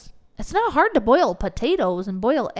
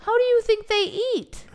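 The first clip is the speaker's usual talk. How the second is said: exasperated; 13 semitones higher than usual. Real, not acted. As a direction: throw the question up in disbelief. Exasperated repeat of her point.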